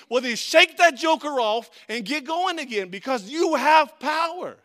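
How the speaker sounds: high, loud fired-up sermon; full-force preaching. Acted. Preacher urging the church to stand in power, loud and high. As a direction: drive it home to the congregation.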